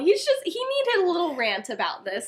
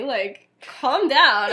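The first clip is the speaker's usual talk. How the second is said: slow calm down; 5 dB louder, much slower than usual. Retold or imitated. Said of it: "And he's like".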